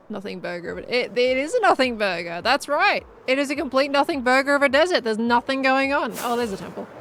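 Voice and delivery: ordinary talk, nothing marked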